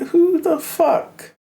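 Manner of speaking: very high, very slow disbelief; squealed, drawn-out who the fuck; incredulous outrage. Retold or imitated. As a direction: stretch it out, outraged. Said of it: "I was like".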